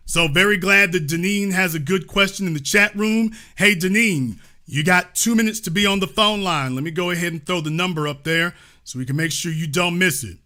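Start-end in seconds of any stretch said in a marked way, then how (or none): none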